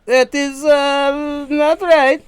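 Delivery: Borat impression.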